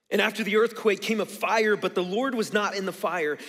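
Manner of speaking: loud, dramatic Bible reading; forceful scripture reading. Acted.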